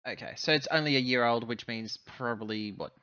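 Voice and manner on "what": ordinary talk, nothing marked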